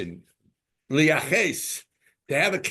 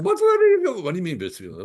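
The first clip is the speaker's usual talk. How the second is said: high, loud outburst of disbelief; mock indignant disbelief; 7 semitones higher, 7 dB louder, faster than usual. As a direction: play someone scandalized by the very question. Acted indignant reaction to decree.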